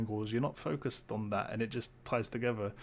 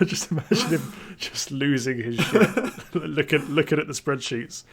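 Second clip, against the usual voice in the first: laughing; 3 semitones higher, 7 dB louder than usual. Real, not acted. Giggling about the Doomslayer's taxes.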